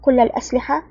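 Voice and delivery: ordinary delivery, nothing marked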